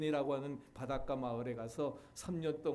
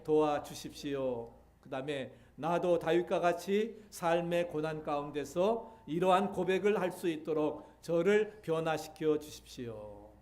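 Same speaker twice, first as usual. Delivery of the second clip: prayer; 3 semitones higher, 5 dB louder than usual. Shown as a demonstration. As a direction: lead the congregation in a heartfelt prayer. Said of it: "여기 있는 말처럼 고백해 보시기 바랍니다". (간절하게 천천히 드리는 기도)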